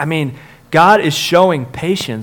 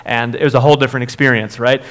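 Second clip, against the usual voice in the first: ordinary talk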